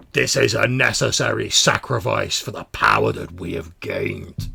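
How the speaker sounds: loud, grandiose declaration; domineering mutant leader; stern larger mutant. Acted.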